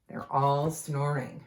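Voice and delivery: plain reading voice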